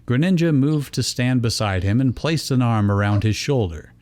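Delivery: ordinary talk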